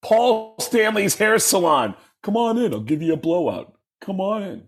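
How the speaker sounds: high-pitched rock frontman as salon owner. Retold or imitated. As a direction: welcome customers like an over-the-top stage singer. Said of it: "do your impression of me".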